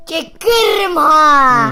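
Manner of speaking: slow loud threat; shouting warning. Real, not acted. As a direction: bark it out as a warning. Child warns parent who is filming.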